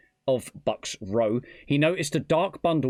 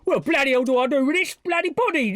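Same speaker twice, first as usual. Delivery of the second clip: high, hammed-up Cockney exclamation; Cockney accent; 11 semitones higher, 4 dB louder, faster than usual. Shown as a demonstration. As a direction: show off a broad mock Cockney accent. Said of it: "that's how you do a good Cockney accent".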